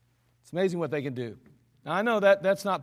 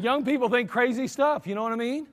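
usual speaking voice

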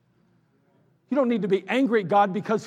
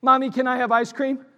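put-on higher child's whine; asking child; 5 semitones higher than usual. Preacher imitating an undisciplined child asking nicely. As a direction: ask like a spoiled kid. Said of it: "like your child".

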